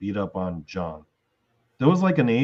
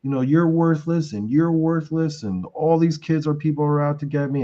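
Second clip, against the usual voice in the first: retold screaming; 7 semitones higher than usual. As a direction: rail at the worthless kids in a hammed-up father voice. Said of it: "Ron's screaming".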